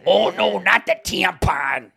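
mock Midwest voice. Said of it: "My impression of you".

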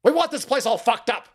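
high, hammed-up yelling at settlers; mock furious high yell; mock angry shout. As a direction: play a ranting mob. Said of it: "And they said".

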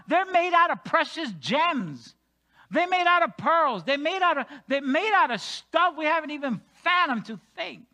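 full-force sermon delivery; fervent preaching. Acted. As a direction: preach it with rising awe.

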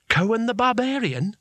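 high, rising astonished question; can't believe it's really him; amazed disbelief. Acted. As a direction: Bethan gasping out the legend's name in disbelief.

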